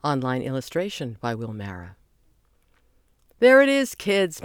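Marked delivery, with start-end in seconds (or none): 3.4-4.4 high, loud and proud; proud announcement. A narrator's reading. Guide proudly presenting the building to kids. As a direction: show off the new building with pride. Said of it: "Mister C said proudly".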